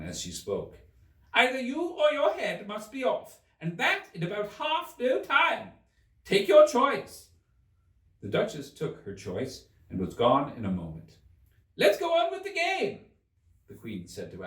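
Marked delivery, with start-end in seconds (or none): 1.3-7.3 very high, loud tyrant queen, slowing on the last words; furious Queen. A narrator's reading. The Queen threatens the Duchess. 11.8-13.1 very high, loud bossy command; imperious Queen. A narrator's reading.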